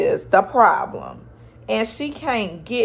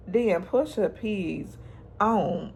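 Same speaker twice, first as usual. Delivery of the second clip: normal talking voice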